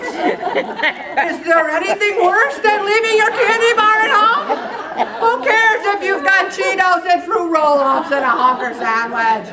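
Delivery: amused incredulous inner voice; laughing disbelief. Real, not acted. Laughing at the child's reason.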